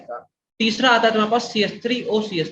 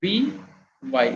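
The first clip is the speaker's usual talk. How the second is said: slow dictation; much slower than usual. Shown as a demonstration. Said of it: "dictating abbreviation slowly".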